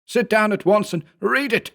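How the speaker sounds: high, insistent order; commanding. A narrator's reading. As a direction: demand it at once. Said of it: "he commanded".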